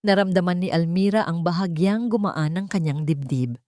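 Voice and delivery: ordinary delivery, nothing marked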